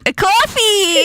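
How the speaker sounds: high, drawn-out New York "coffee"; New York accent. Shown as a demonstration. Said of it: "Crocs wants you to say coffee".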